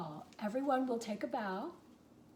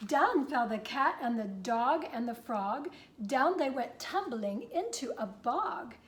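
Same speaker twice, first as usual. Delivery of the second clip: lively rhyming read-aloud; story narration; 2 semitones higher, 6 dB louder than usual. A narrator's reading. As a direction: read it to kids, bouncing the rhyme. Reads the picture book aloud.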